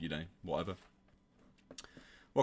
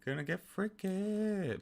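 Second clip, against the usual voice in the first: high, slow sing-song tease; mock suggestive voice; teasing; 12 semitones higher, much slower than usual. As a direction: draw it out suggestively. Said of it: "Ambrose and Jane are gonna get freaky".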